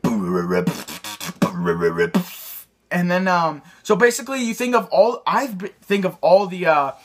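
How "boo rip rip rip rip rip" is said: very low rumbling rip sounds; deep throat-voice beatbox. Shown as a demonstration. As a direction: grind the rips out deep in the throat. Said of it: "say 'em in that voice".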